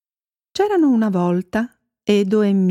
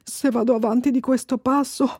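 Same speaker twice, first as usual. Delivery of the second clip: higher, anxious thinking aloud; worried, short of breath; 4 semitones higher than usual. A narrator's reading. Babbo Natale alone in the woods, worried. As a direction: fret as if winded. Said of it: "Aveva il fiato corto ed era molto preoccupato".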